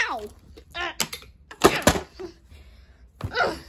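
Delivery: ordinary delivery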